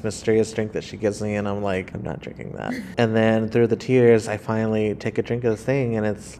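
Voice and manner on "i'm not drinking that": whispered refusal. Retold or imitated. Refusing, through tears.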